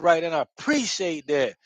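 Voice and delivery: normal talking voice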